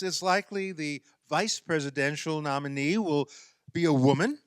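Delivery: ordinary talk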